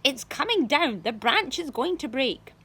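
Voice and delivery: loud, urgent warning; alarmed shout. A narrator's reading. Aunt Sponge alarmed at branch.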